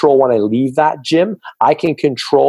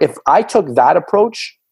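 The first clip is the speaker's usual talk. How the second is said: usual speaking voice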